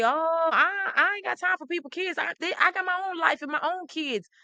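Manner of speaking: high, mocking brush-off of a careless celebrity; mock the artist who can't be bothered; dismissive artist. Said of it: "I don't care if you say".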